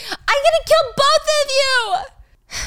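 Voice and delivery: high, slow, strained shriek; shrieking threat. Retold or imitated. Gia shrieking her threat at Michael and Stacy, high and slow. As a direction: drag out the threat in a wild screech.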